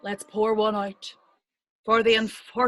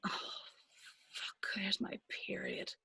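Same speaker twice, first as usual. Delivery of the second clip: barely audible, whispered dread; dismayed whisper; 3 semitones lower, 19 dB quieter than usual. Acted. Telling friends of the worst feeling, whispered dismay. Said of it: "The best and worst feeling".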